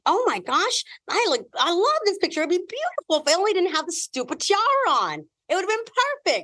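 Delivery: high, gushing delight over a photo; retelling her own thrilled reaction; excited gush. Retold or imitated. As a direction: gush about the picture, voice way up.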